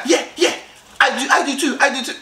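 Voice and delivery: high-pitched, over-eager agreement; overexcited. Acted. Mock eager guy agreeing with a girl he just met, overexcited. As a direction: gush as if far too keen. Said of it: "you're so excited, you're like".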